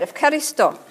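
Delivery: "Efkaristo" with an f sound, Greek style; Greek pronunciation. Shown as a demonstration. Preacher telling congregation how Greeks say "Efkaristo", with an f. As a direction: say "Efkaristo" as ef-ka-ri-STO. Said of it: "that's how they say it".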